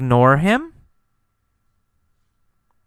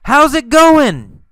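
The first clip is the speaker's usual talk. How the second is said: loud, high-pitched call; yelled; 10 semitones higher, 5 dB louder than usual. Acted. Teacher answering what Carlos shouted, yelled. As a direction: shout the greeting as Carlos would. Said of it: "What did he yell?".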